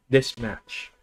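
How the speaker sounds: ordinary delivery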